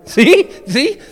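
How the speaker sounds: high laughing "See?"; real laugh breaking into the word; laughing triumph. Real, not acted. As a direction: burst out laughing, pointing it out.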